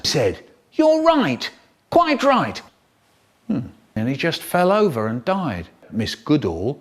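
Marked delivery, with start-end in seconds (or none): 0.7-2.6 high-pitched acted agreement; lifted, high character reply; high-voiced reply. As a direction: agree in a much higher voice.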